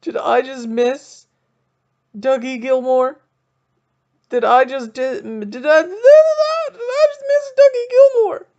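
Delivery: rising into a high, loud, cracking wail; hammed-up fan panic, building to a cry; panicking. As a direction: spiral from worry to a near sob. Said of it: "I'm freaking out internally".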